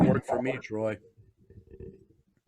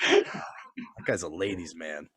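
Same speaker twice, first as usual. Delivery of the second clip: ordinary talk, nothing marked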